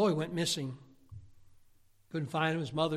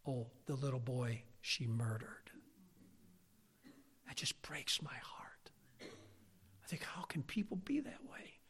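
whispered, heartbroken aside; hushed grief; 9 dB quieter than usual. Real, not acted. Preacher whispering to the congregation in grief. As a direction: breathe it out, stunned by the horror.

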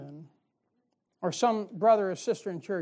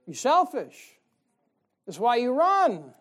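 higher, louder, slow hammering; preaching rebuke; 5 semitones higher, 8 dB louder, slower than usual. Real, not acted. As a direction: drive the accusation home hard.

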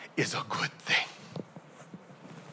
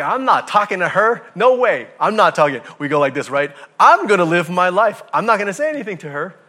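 louder, stubborn huff; mock sulking husband; 3 semitones higher, 7 dB louder than usual. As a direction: play the proud husband who won't talk. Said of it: "And I'm just like".

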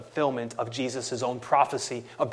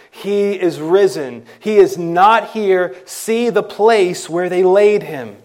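slow, deliberate reading building louder; slow scripture reading; 3 semitones higher, 10 dB louder, slower than usual. Acted. Preacher reading the angel's words to the congregation, slow and building. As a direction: read the angel's words slowly, weighing each.

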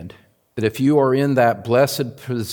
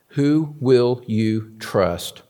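each word slowly set apart; half-speed, weighty repeat of the question; slow, deliberate question; much slower than usual. Real, not acted. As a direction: draw out WHO WILL YOU TRUST, word by word.

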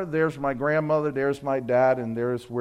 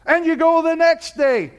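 high, loud, building sermon delivery; impassioned preaching; 10 semitones higher, 6 dB louder than usual. Acted. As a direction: paint the scene with rising fervor. Preaching climax.

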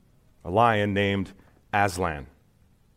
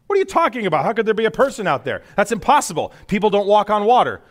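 high, loud, incredulous retort; disbelieving disciple; 9 semitones higher, 5 dB louder, much faster than usual. Acted. Disciple dismissing his friend's sighting, acted loud disbelief. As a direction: argue back fast in sheer disbelief. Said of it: "Imagine what they're saying to each other".

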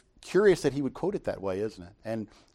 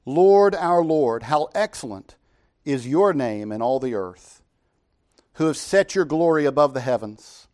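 scripture reading; 4 dB louder than usual. Acted. Preacher reads Psalm eight to the congregation, solemn and proclaiming.

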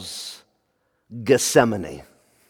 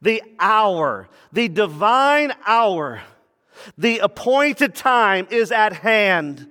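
raised, driving sermon voice that keeps building; preaching; 7 semitones higher than usual. Acted. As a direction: preach it to the church with rising force. Preaches the Gethsemane passage.

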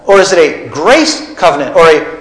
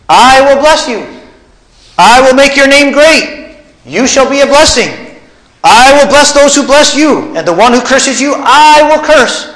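booming proclamation; 6 semitones higher, 3 dB louder than usual. Acted. Preacher voicing God's promise to the congregation, booming proclamation. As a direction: declare each promise like the voice of God.

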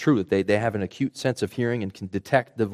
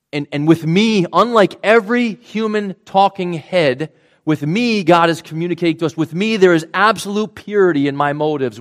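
raised, emphatic divine declaration; God; 6 semitones higher, 7 dB louder than usual. Acted. God telling his people what he is like, emphatic. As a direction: preach it louder and higher, with full conviction. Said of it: "that God wired into the world to say".

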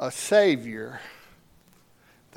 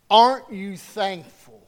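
usual speaking voice

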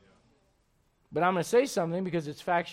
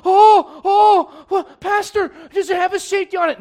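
high, loud, breathless mock panic; panicked congregant; 12 semitones higher, 15 dB louder than usual. Pastor mimics a jumpy congregant handed a gun, high shouting panic. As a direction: squeal like a startled churchgoer handed a Glock. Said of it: "You go".

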